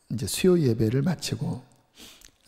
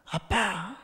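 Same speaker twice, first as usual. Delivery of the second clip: put-on child's call; 7 semitones higher, 5 dB quieter, much slower than usual. Child running to father.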